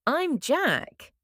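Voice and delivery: ordinary talk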